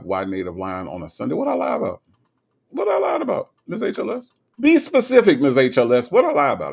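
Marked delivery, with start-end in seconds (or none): 1.3-4.3 high-pitched mock protest; playfully affronted, voice shooting up; mock indignant. Mock protest at viewer's accusation. 4.6-6.7 mock indignant. Mock challenge to viewer's accusation.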